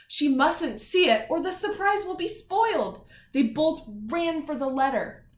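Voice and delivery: storybook reading with energy; story narration. A narrator's reading. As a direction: read it out for the kids. Reads story to children.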